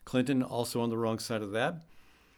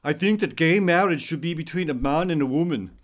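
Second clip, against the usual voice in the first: put-on a bit louder, heavy Austrian accent; Arnold Schwarzenegger accent; 4 semitones higher, 4 dB louder than usual. Quoting the governor to the audience in an Austrian accent. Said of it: "I'm gonna go with the accent on this one".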